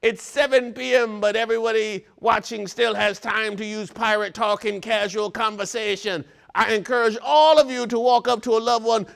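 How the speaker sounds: high, loud hammed-up pirate; pirate talk. Urging everybody watching to use pirate talk, pirate voice. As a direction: deliver it all as a rousing pirate. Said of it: "without talking like a pirate".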